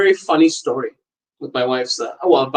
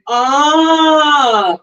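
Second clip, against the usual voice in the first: sudden realization; 11 semitones higher, 4 dB louder than usual. Retold or imitated. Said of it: "I was like".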